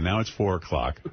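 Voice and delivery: usual speaking voice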